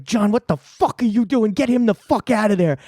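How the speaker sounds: high-pitched outraged scolding; retold angry yelling. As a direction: act out an alarmed boss yelling orders. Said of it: "he's like".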